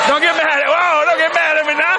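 high-pitched playful backpedalling; high, teasing protest to a riled audience; mock alarm. Playfully fends off the crowd's reaction.

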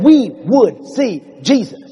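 slow, drawn-out words; slow emphatic quoting. Acted. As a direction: stretch it out, one word at a time. Peter repeats the Greeks' words emphatically.

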